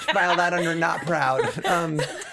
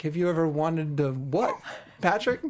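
slow, puzzled repeat in a normal-sized voice; questioning, stretched out before the name; baffled echo; 3 semitones lower than usual. Retold or imitated. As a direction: imitate a friend wondering what he just overheard. Friend repeats overheard question, baffled.

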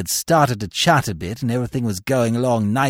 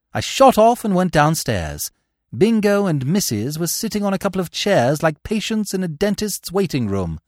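ordinary delivery

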